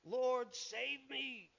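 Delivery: loud desperate call for help; a sinking man calling to be saved; crying out. Retold or imitated. Peter sinking in the waves, loud cry for help. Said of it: "he cried out".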